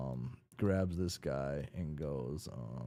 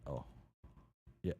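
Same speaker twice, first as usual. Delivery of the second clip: normal talking voice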